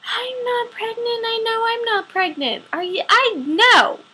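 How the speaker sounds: high, strained, desperate insistence; panicked denial. Retold or imitated. Patient refusing the doctor's diagnosis: high, panicked denial. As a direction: plead it away, pitch climbing.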